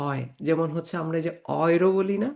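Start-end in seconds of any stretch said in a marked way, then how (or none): 0.1-0.2 "অয়" as one gliding "oy" sound; German diphthong demo. Shown as a demonstration. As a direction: say "অয়" like "oy" in boy. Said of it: "এটার উচ্চারণ হচ্ছে". ("অয়" একটানা যুক্ত স্বরে)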